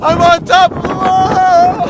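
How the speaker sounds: loud, high, slow call; shouting over wind. Real, not acted. On wall in strong wind.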